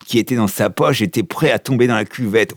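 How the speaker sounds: ordinary delivery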